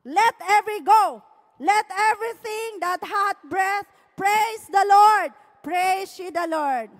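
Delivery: proclaimed scripture. Shown as a demonstration. Preacher reading the psalm aloud to all. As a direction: read it out like a proclamation. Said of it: "basahin natin lahat to".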